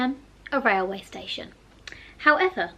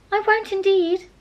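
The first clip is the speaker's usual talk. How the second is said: high, louder rushed reassurance; hurried promise; 9 semitones higher, 7 dB louder than usual. A narrator's reading. Alice hastily promises the mouse, high and loud. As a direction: hurry to change the subject. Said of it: "in a great hurry".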